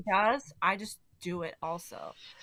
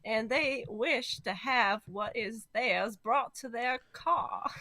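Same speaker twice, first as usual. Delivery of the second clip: put-on Southern belle accent; 3 semitones higher, 6 dB louder than usual. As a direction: drawl it like a Southern lady.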